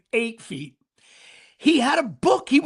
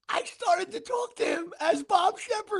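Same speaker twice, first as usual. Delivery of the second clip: laughing; 9 semitones higher, 3 dB quieter than usual. Real, not acted. Laughing while telling the story.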